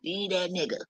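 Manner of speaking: a hint of a busybody giving bad advice; mock gossip whisper-soft; mocking a meddling friend. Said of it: "I am not the female to tell somebody".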